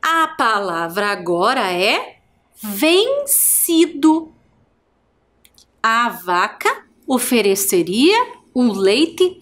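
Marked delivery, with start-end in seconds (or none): 2.6-4.3 vencido drawn out high and slow; slow, high reveal. Shown as a demonstration. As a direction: unveil the word slowly. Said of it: "Quantas sílabas eu tenho a palavra vencido". (revelando a palavra devagar e agudo)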